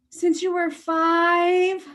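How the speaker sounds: real surprise at the news; shocked gasp. Real, not acted. Reacting to cat's death.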